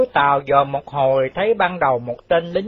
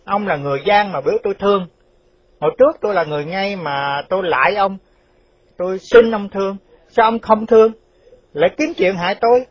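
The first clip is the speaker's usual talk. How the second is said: indignant retort; 5 semitones higher than usual. Acted. Retorting bitterly to the plea.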